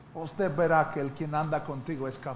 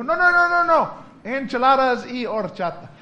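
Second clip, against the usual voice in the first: emphatic refusal; 8 semitones higher, 11 dB louder than usual. Retold or imitated. American refuses tacos emphatically. (rechazo gritado y pedido lento y orgulloso)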